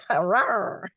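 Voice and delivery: laughing mock tigress rawr; playful growl. Joking back at being called sexy, playful growl.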